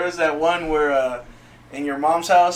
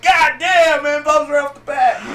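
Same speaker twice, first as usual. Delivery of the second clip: loud, high, laughing disbelief; shocked exclamation; 11 semitones higher, 8 dB louder than usual. Retold or imitated. Onlookers reacting to her outburst, loud shock. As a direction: yell it out, startled. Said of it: "They're like".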